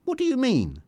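very high, startled question; high-pitched surprised query. A narrator's reading. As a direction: ask it taken aback.

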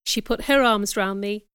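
ordinary delivery

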